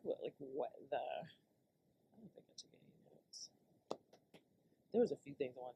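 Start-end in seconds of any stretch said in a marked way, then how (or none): 2.2-3.5 breathy whispered aside; whispering. Real, not acted. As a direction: whisper it to yourself.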